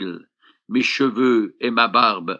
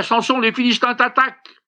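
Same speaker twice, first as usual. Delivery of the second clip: much higher, urgent alarmed cry; 11 semitones higher, faster than usual. Acted. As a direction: shout the warning as if it's real. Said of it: "Puis elle s'écria". (cri d'alerte très aigu et pressant)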